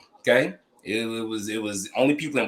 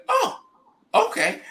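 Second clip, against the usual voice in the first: surprised; slower than usual. Retold or imitated. Girlfriend's surprised reply, retold.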